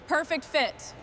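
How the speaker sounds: ordinary talk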